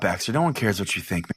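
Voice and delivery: usual speaking voice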